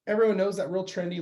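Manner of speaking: ordinary talk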